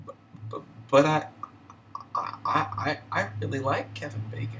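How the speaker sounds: high, halting mock-tearful voice; quivering, hurt. Crushed woman answering the store worker who insulted her, hurt and quivering. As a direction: imitate someone wounded, stammering up high on the verge of tears. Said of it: "her chin's kinda quivering".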